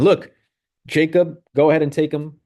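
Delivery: dismissive. Retold or imitated. Rachel handing Jacob over.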